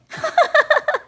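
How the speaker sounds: high crow cackle; sly cackle. Acted. Crow laughing to Kalu, gleeful cackle. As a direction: cackle with glee.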